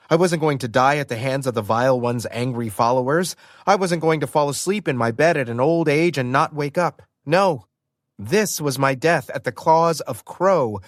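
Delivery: usual speaking voice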